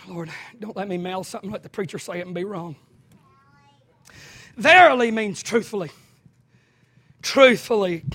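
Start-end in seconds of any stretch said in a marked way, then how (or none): 0.1-2.8 soft, low, quick mutter; mock nervous mutter. Preacher imitating a nervous churchgoer, soft quick mutter. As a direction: mumble it fast so nobody hears. Mimicking nervous congregation's inner prayer.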